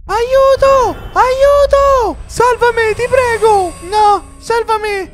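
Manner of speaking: put-on shrill captive kid calling for help; desperate cries for help. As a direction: beg to be rescued. Captive kids cry for help.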